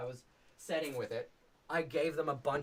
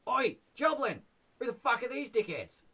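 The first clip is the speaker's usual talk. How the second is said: higher, louder goblin calling out to his mate; Toblin voice; 10 semitones higher, 5 dB louder than usual. Shown as a demonstration. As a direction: bark it across the bridge like a grumpy goblin. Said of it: "he will be more like".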